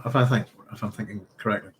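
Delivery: ordinary talk, nothing marked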